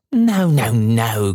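high, gleeful denial; delighted cry. A narrator's reading. Daughter enjoying father's wrong guess, delighted cry. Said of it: "cried Meg, delighted".

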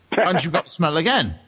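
ordinary talk

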